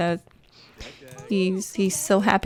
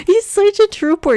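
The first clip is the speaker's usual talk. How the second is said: higher and louder, through laughter; laughing; 12 semitones higher, 5 dB louder than usual. Real, not acted. Laughing at her sim eating spoiled food, higher and louder. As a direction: squeal it out mid-laugh. Laughing at sim's rotten pork chop.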